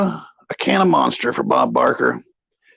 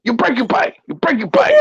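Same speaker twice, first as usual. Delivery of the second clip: Colonel voice; 9 semitones higher than usual. Pretend. Continues the colonel bit.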